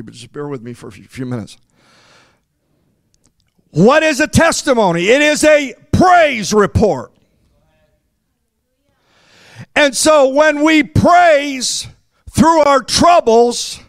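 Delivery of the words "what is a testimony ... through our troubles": higher, slow, shouted preaching. Acted. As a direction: drive the point home slowly. Preaching at full force.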